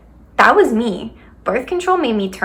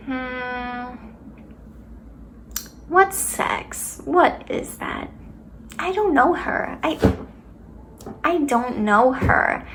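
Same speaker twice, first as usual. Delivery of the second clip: high, soft, airy feigned ignorance; mock cluelessness; 3 semitones higher, 7 dB quieter, much slower than usual. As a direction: play innocent, quietly and higher. Acting clueless about sex in retold reaction.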